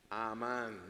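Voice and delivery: "Amán" slowly, long first A, stress on the last syllable; low, drawn-out "Amán"; Hebrew pronunciation. Shown as a demonstration. Preacher revealing how the Hebrew word is pronounced, slow and drawn out. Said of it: "¿Saben cómo se pronuncia eso?".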